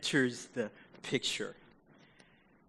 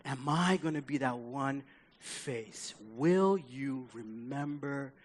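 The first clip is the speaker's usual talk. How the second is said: soft, slowed, imploring voice; earnest pleading; 4 semitones lower, 6 dB quieter than usual. Acted. Dying thief begging Jesus to know him, pleading softly. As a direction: sink into a hushed, heartfelt plea. Thief's worried plea to be known.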